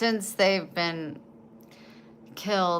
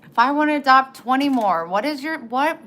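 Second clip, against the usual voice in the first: higher, louder indignant protest; indignant outburst; 8 semitones higher, 8 dB louder than usual. Retold or imitated. Retelling arguing with her parents about adopting kittens, indignant. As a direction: push back hard, fed up. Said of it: "I was like".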